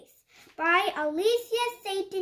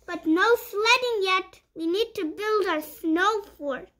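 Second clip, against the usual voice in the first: lively picture-book read-aloud; reading to children; 5 dB louder than usual. Acted. Reading a puppy picture book to young viewers, bright storytime voice. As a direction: read it like storytime for little kids.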